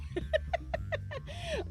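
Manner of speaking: high giggling laugh. Real, not acted. Cracking up on stream.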